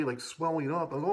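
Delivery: ordinary talk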